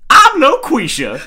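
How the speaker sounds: very high, loud mocking falsetto; falsetto LaQuisha voice. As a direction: shriek the name in a put-on high woman's voice. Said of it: "and goes".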